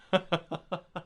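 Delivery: chuckle. Real, not acted. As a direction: laugh lightly in a quick run. Laughs at the death screen.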